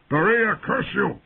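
high-pitched furious bellow; roared. A narrator's reading. Knight calling a frightened handmaid, roared. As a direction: roar a curse across the camp. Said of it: "Ser Jorah roared".

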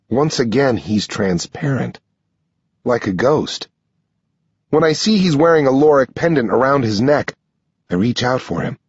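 usual speaking voice

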